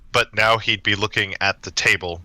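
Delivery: ordinary talk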